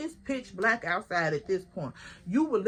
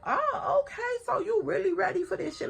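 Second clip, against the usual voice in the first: much higher, quicker goading; mocking taunt; 9 semitones higher than usual. Goading the rival into round two, high and fast. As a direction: egg her on like it's a joke.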